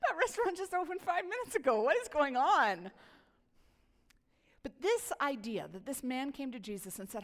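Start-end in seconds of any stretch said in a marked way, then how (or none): 0.1-2.9 higher, louder, incredulous mock outburst; hammed-up amazement, slowing on the last words; mock disbelief. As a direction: play up the shock at feeling old. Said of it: "we were like".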